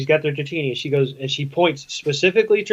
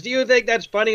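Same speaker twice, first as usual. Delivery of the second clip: raised-pitch confrontational demand; mock indignant; 7 semitones higher than usual. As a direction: lightly act out an angry challenge. Retold angry character confronting Ditto.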